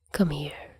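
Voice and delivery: hushed, gentle come here; tender quiet invitation; small and soft. Acted. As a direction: coax him close, barely above a breath. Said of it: "Tony's voice was small and soft".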